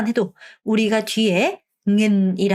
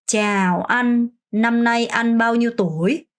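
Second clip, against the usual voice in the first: slow, clear Vietnamese question; slow model phrase; much slower than usual. Shown as a demonstration. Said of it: "문장 끝". (천천히 또박또박 시범 질문)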